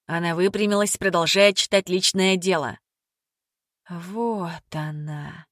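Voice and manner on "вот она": barely voiced, slow whisper. A narrator's reading. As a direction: breathe it out in awe. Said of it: "прошептала".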